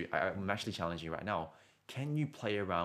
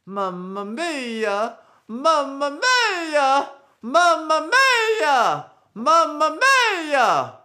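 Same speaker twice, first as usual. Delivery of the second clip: climbing higher and louder, stretched very slow; exaggerated Mario affect; 17 dB louder, much slower than usual. Shown as a demonstration. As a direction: wail it up like Mario into the high range. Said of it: "when I add that affect".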